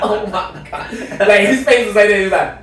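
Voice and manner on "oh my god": mock stressed groan. Mimicking the stressed guy.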